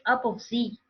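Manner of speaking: "up of Z" as three familiar words; misheard pronunciation. Shown as a demonstration. Teacher giving what learners find familiar, misheard pronunciation. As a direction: say "up of Z" with Z as "zee". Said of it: "you are familiar with something like".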